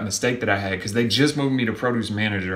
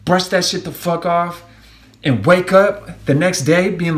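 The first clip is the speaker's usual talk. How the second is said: higher, louder, pushing each word out slowly; fired-up pep talk; 6 semitones higher, 5 dB louder, slower than usual. Real, not acted. Vlogger urging viewers to shake off bad days, fired up. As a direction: pump them up to shake it off.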